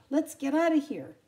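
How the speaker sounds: loud, high alarmed call; scared bully. Acted. Bully dog fleeing Tramp, loud and high. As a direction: yelp it as you bolt.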